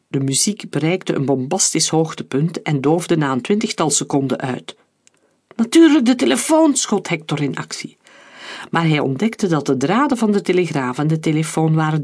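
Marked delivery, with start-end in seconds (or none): 5.5-6.7 sudden realisation. A narrator's reading. Sudden realisation, rushes to act.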